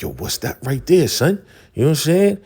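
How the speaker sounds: mock hyped-up, high-pitched questioning; excited reaction. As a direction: act out being blown away by a new sound. Acting Ghostface hearing the new sound.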